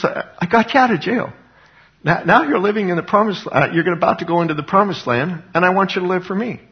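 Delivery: high-pitched informal paraphrase of God; voicing God. God reminds his people of their rescue, high-pitched imitation. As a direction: play God making a casual point, pitched well up. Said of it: "God was saying".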